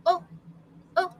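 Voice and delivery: "oh" as a short, open vowel, repeated apart; relaxed short O. Shown as a demonstration. Teacher repeats the short O for students, relaxed vowel demo. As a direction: say each "oh" loose and brief. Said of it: "It's more relaxed than your short".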